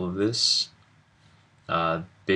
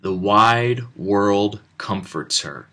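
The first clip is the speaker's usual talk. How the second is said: slow, loud, deliberate storybook reading; picture book read-aloud; 5 dB louder, much slower than usual. Shown as a demonstration. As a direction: read it out slowly and clearly for the recording. Recording picture book page aloud.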